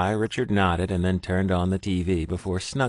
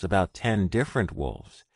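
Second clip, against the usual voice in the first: plain reading voice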